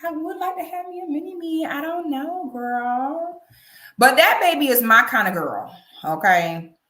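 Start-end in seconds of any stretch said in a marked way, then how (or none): 0.0-3.4 higher, quick, daydreaming about a mini me; breezy fantasy voice, a touch higher; dreamy wishful voice. Retold or imitated. Host voicing her own wish for a baby girl, higher and airy. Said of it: "But then I be like".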